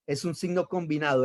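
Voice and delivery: ordinary talk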